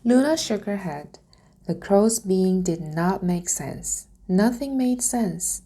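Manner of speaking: usual speaking voice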